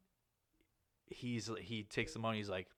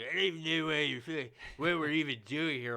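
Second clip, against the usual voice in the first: put-on fast, high, loud slurred drunk voice; drunk girl; 5 semitones higher, 5 dB louder than usual. Drunk promo girl rambling at the booth, fast and high. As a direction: babble like a wasted girl.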